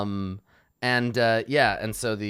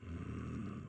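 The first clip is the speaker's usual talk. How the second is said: whispered hum; 2 semitones lower, 13 dB quieter than usual. Acted. Vampire lord pondering.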